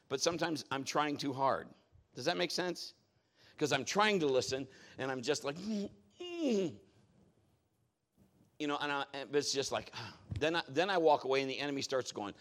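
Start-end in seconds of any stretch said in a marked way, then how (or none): 5.6-6.8 high strained "mm"; straining hum. Retold or imitated. Preacher mimics straining to hear God, effortful hum. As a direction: hum as if trying too hard.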